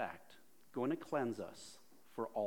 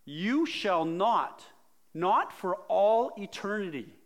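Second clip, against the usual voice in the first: loud, very slow, booming command; Gandalf voice; 8 semitones higher, 12 dB louder, slower than usual. Retold or imitated. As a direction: Peter refusing like a wizard barring the way. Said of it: "think of this in almost that Gandalf voice".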